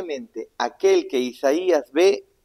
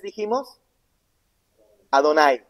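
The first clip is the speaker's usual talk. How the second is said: ordinary talk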